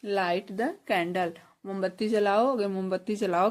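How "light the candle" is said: slow drill. Shown as a demonstration. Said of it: "इसे बोलेंगे light the candle".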